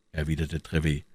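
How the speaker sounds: ordinary delivery, nothing marked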